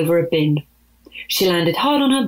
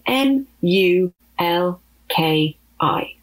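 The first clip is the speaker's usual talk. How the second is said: slow letter by letter spelling; spelled out slowly; much slower than usual. Acted. Malaki spelling her own name slowly for Opie. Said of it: "Malaki said".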